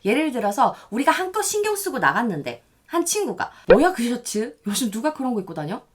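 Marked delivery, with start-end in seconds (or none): 3.7-5.8 lightly acted sarcastic put-down; sneering. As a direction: imitate a friend sneering at an outfit. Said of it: "라고 비아냥거린".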